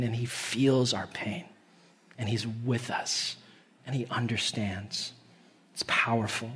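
soft sincere preaching hush; tender and hushed. Real, not acted. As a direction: let it sink in gently. Tender point about God's compassion.

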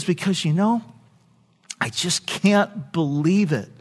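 put-on soft, halting child overcome; gently imitated crying child; imitating tearful boy. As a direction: pause and soften, as if mildly choked up. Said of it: "He's really crying".

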